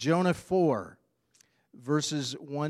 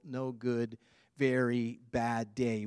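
ordinary delivery

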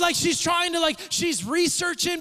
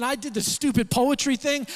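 ordinary talk, nothing marked

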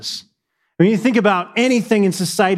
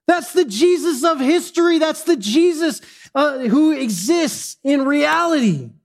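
preaching at full force; 10 semitones higher than usual. Acted. Pastor crying out to the congregation about his king. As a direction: lift the room, preach it out.

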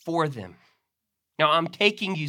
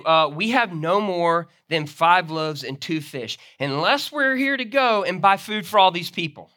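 louder, higher flustered excuse; protesting disciples; 3 semitones higher, 7 dB louder than usual. Acted. Preacher voicing disciples protesting to Jesus, flustered. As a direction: plead that it's impossible, voice rising. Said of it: "They said".

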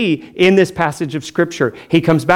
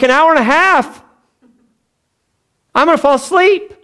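much higher, incredulous whine; shocked squeal; 12 semitones higher, 3 dB louder than usual. Retold or imitated. Pastor mocking his younger self at a prayer meeting, high shocked whine. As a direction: squeak it out in disbelief. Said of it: "I was like".

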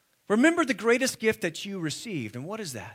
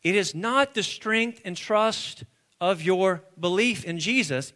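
ordinary delivery

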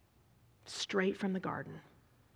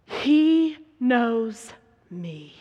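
"He knows me" loud and very slow, tapering on "me"; loud, drawn-out "He... knows... me", each word set apart; emphatic realisation; 3 semitones higher, 11 dB louder, much slower than usual. Retold or imitated. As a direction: stretch "He" and "knows" out with force. Hagar's sudden realisation, acted out.

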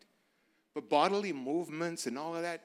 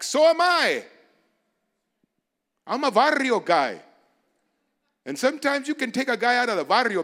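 loud, high preaching cry; preacher rising to a shout; 9 semitones higher, 9 dB louder than usual. Real, not acted. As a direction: call it out to the whole congregation.

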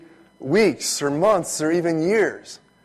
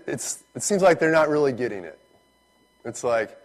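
normal talking voice

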